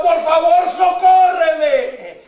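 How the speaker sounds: fervent plea with a long-held "socórreme"; begging loudly. Acted. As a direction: cry out for help, stretching the last word. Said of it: "que hincada le rogaba". (ruego clamoroso y prolongado)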